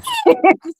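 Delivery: short, high-pitched laugh; brief high giggle at your own remark; real laugh. Real, not acted. After saying the rabbit becomes dinner, real laugh.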